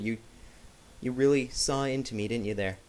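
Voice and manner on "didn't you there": ordinary talk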